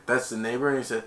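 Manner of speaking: ordinary delivery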